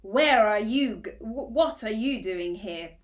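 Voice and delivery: stern dog interrogating, loud; growled. Acted. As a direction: growl it at the intruder. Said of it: "growled the watchdog".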